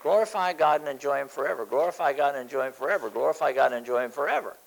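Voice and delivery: fast, repeated chant-like refrain; a steady inner voice repeating itself quickly. Retold or imitated. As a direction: rattle it off like a mantra running in your head. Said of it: "this little thing inside of me that keeps saying".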